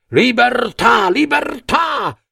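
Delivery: high, stretched-out cry of freedom; rising crowd shout. A narrator's reading. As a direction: climax of the chant, pitched high. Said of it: "si levarono tutti in piedi gridando". (grido finale acuto della folla)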